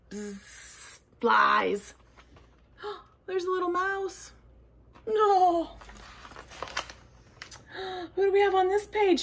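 ordinary delivery, nothing marked

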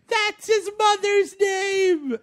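hammed-up high shout of the news; mock Lois Lane. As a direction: belt it high and slow. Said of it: "Lois Lane's like".

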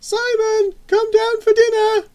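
very high, loud call through the house; mother calling out. A narrator's reading. Mother calling her son down for dinner, shrieking call. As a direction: holler for a child upstairs. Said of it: "a female voice interrupted the action".

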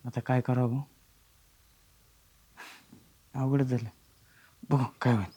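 soft, dejected muttering to himself; quiet worried sigh after the call; defeated murmur. Acted. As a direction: mutter it low, out of options.

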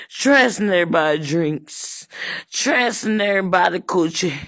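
usual speaking voice

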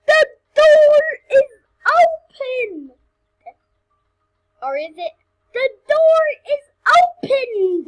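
high, loud hammed-up wail; mock wailing. Player wailing at a door to viewers, hammed-up cry. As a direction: wail like a melodrama heroine. Dramatic fake despair at door.

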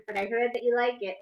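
ordinary delivery

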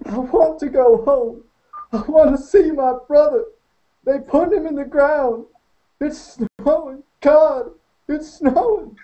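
wailing. Acted. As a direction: wail it like a lost child. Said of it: "he wailed".

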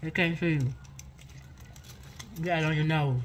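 chewing while talking; speaking around food; mouth full of pastry. Real, not acted. Seen 0: Front woman bit pastry, frosting on lips, chewing; speaker unsure.

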